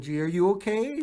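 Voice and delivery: high mock concerned wife voice; imitating wife. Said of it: "she come in and".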